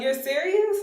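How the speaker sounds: high-pitched disbelieving question; re-enacted surprise, voice lifted high; incredulous. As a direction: imitate a delighted double-check. Aunt checks the news is true.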